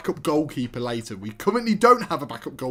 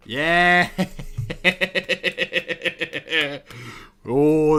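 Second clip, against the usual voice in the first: triumphant yeah; 4 dB louder than usual. Real, not acted. Manager's offer accepted, loud triumphant cheer. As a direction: celebrate the win.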